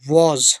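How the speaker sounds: was said as वाज, with a w sound; the right reading was, short and clear; correct pronunciation. Shown as a demonstration. Teacher giving pupils the correct word. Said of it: "गलत है ये".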